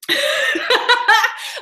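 high giggling burst; high laugh. Real, not acted. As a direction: squeal with laughter. Laughing at joke.

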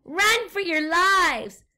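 high, loud, slow cry; panicked shout. A narrator's reading. Explorer guinea pig yells at the pigs to flee. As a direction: cry out in alarm. Fleeing the monster.

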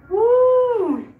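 high, loud cheer of relief; excited whoop. Real, not acted. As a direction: let out a victory whoop. End of final set.

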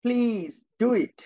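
Please do it drawn out slowly word by word; teacher slowly modelling a polite request for the class. Shown as a demonstration. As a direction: stretch Please do it out like a gentle request. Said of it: "আমি আবার কি বললাম".

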